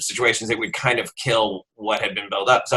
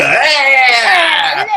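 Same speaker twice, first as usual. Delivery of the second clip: loud high cheer; party cheer; 12 semitones higher, 8 dB louder than usual. Pretend. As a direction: whoop it up like a party. Joins the mock party cheer.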